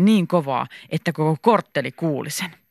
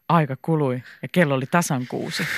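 plain reading voice

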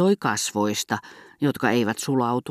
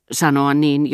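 ordinary delivery

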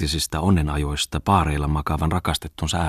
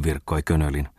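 plain reading voice

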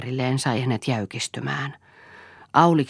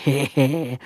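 put-on light he-he-he chuckle of an old man; a childlike old-man giggle. A narrator's reading. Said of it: "Larsen nauroi kuin lapsi".

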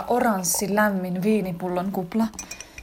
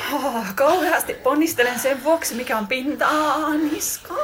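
philosophise breathlessly while building towards a climax, voice climbing and wailing; moaning in lovemaking; 7 semitones higher than usual. Acted. Lover rambling on about mushrooms during sex, high ecstatic moaning.

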